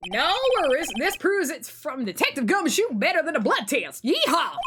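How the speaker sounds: breezy cowboy voice ending in a whoop; Western accent. Acted. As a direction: play a Southern-sounding Western lawman. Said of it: "trying to do a Western accent".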